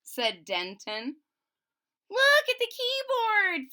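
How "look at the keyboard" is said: very high, bright thrilled call; excited. Acted. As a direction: point it out with delight. Kids thrilled by instruments.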